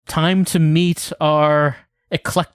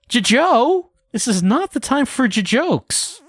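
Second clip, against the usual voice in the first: high stuttering, rattled; flustered stammer; 10 semitones higher than usual. Acted. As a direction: trip over the words, upset.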